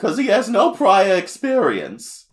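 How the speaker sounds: slow, high, loud cartoon voice; exaggerated drawn-out character impression; Chef Kawasaki. The chef turning Tiff down, loud high impression. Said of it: "he talks like this".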